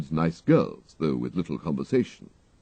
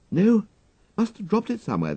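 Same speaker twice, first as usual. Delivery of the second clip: put-on higher, breezy young toff; young gentleman Wooster; 14 semitones higher, 4 dB louder than usual. A narrator's reading. Wooster asks his valet for a cigarette, breezy toff voice. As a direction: cadge a smoke lightly, upper-class chap.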